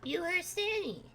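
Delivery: put-on a lost kid looking around; small confused child calling out; Rad the kid. GM voices Rad.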